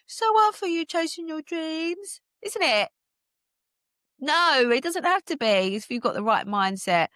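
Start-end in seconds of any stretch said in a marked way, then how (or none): 0.1-2.9 high, slow fake-sympathy whine; mock pity voice. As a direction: stretch so hard up high, pitying. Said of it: "Love the drama of that".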